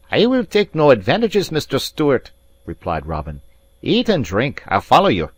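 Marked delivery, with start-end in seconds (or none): 0.1-2.3 higher, louder haughty Highlander; haughty Scots rival, raised and proud; stiff proud Robin. Acted. 3.8-5.3 higher, louder haughty Highlander; haughty Scots rival, raised and proud; stiff proud Robin. Acted. Robin insisting politely.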